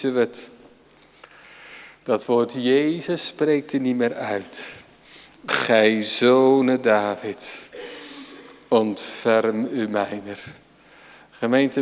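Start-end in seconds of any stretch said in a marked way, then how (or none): 5.5-10.4 slow, earnest prayer; slow plea. Acted. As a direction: plead each word slowly. Repeated Bartimaeus prayer.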